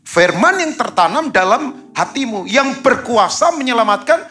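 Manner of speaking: high, loud, lifted reading of the verse; solemn preached Bible reading; scripture reading. Acted. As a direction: proclaim the verse to the congregation.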